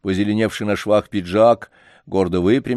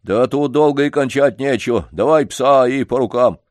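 eager janitor; 5 semitones higher, 5 dB louder than usual. Acted. Janitor voiced in story.

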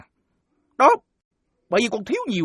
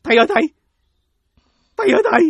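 loud pleading call to the master; raised beseeching calls; 2 semitones higher, 6 dB louder, much faster than usual. Acted. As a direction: call across the temple yard. Calling out to monk at temple.

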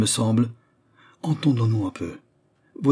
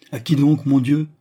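higher, louder exasperated question; alarmed, raised-voice question; startled exclamation; 5 semitones higher, 5 dB louder than usual. Acted. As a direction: cry out in disbelief.

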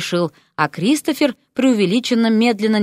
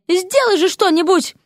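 high, outraged demand; indignant demand; 11 semitones higher than usual. A narrator's reading. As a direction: demand action in a raised pitch. Offended lady demands action.

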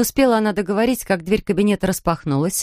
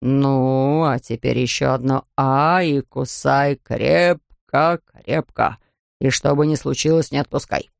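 low, deliberate dentist voice; slow, deep coaxing instructions; instructing dentist; 5 semitones lower, slower than usual. A narrator's reading. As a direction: stretch the key words in a deep voice. Dentist instructing his little patient.

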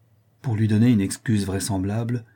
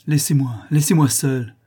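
high, louder plea to be left alone; crying out; 5 semitones higher, 4 dB louder than usual. A narrator's reading. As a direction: cry out, breaking away. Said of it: "en criant".

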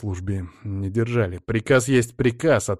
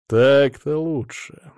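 smug satisfaction; 4 semitones higher, 5 dB louder, slower than usual. A narrator's reading. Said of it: "довольно ухмыльнулся безопасник".

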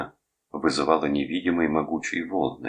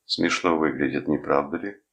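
ordinary delivery

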